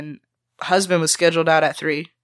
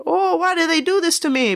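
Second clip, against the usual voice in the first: much higher, put-on voice of the manager wailing; whiny boss lament; 12 semitones higher than usual. Cook mocking the GM's complaint, high whiny impersonation. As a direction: moan it like a self-pitying boss.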